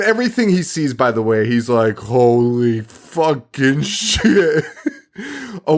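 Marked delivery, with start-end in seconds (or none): 2.0-4.6 hugely drawn-out stunned disbelief; very slow, mock-dumbfounded cop; mock awe. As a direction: stretch every word, hamming up the amazement. Mocking cop amazed at everything.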